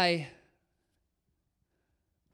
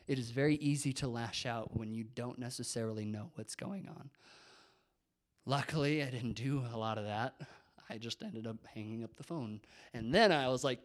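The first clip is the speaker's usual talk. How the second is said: normal talking voice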